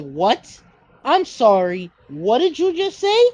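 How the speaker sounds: loud, incredulous American challenge; mock indignant American. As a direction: act affronted, rising high on the final question. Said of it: "Americans are just like".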